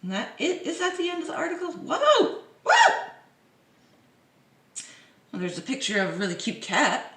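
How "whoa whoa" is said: very high, louder squeals; surprised whoa. Real, not acted. Vlogger seeing the article end, squealing surprise.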